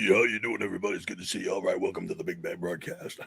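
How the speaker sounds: sudden low, quiet put-on voice; weird deep voice. Cohost greets viewers in a strange low put-on voice. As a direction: drop into a deep muttering stranger. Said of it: "What the hell was that?".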